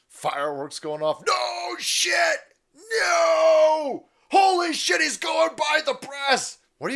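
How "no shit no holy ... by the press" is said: high, loud panicked yelling; hammed-up screaming agent; mock panicked shout. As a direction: yell it like a bodyguard losing it.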